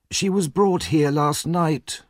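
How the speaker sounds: put-on a gossiping old nurse, taking her time; lightly voiced old workhouse nurse. A narrator's reading.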